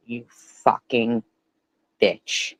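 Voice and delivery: ordinary talk, nothing marked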